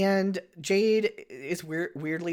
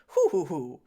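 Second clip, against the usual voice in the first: put-on old lady chuckle; 5 dB louder than usual. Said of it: "old person, like, mannerisms".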